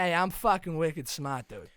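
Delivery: put-on loud, drawn-out Boston accent. Film hero bragging he's smart, loud Boston accent. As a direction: brag it in thick, loud Boston. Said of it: "heaviest Boston accent".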